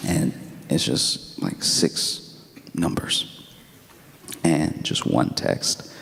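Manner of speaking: slow, soft, half-whispered; slow breathy hush to build suspense. Real, not acted. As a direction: draw it out in a hush.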